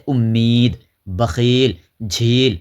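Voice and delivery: teacher lingering on the "ee" of امید, بخیل, جھیل; drawn-out long i. Shown as a demonstration. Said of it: "ہم کھینچ کر پڑھیں گے".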